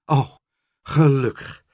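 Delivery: heartfelt relief; relieved. A narrator's reading. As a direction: let the worry go with feeling. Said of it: "zei meneer Pen opgelucht".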